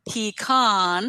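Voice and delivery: PECAN as PEE-KAHN, long AH; second pronunciation variant. Shown as a demonstration. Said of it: "four ways to pronounce it".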